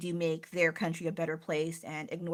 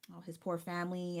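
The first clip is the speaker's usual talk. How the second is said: ordinary delivery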